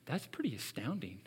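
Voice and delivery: awed whisper. Retold or imitated. Preacher voicing his own awe at the verse, whispering. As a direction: breathe it out in wonder. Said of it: "I come to that and I go".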